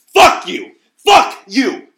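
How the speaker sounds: screaming at the top of his lungs, high and loud; furious shout. Retold or imitated. Man on the couch screaming at his attacker, furious full-lung shout. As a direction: roar it out in blind fury. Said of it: "at the top of my lungs in a fury".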